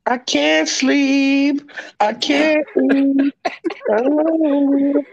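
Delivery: slow, high, drawn-out moaning; hammed-up lovesick lament; mock heartbroken wail.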